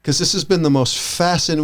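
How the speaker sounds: ordinary delivery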